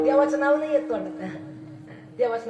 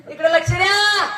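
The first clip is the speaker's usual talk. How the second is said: louder, higher call for attention; 5 semitones higher, 4 dB louder than usual. Real, not acted. Preacher calling the distracted audience to attention, loud. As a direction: snap the crowd back to listening.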